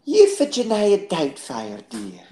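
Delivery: lightly put-on Scottish granny; Mrs. Doubtfire. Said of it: "a Missus Doubtfire impression".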